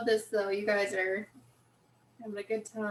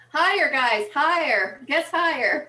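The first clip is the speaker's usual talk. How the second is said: cheering them higher, loud; loud urging; 4 semitones higher, 10 dB louder than usual. Real, not acted. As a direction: call out loud to the crowd. Urging the viewers to guess.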